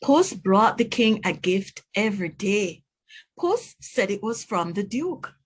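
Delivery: plain reading voice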